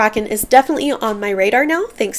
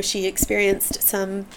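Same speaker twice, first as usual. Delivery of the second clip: ordinary delivery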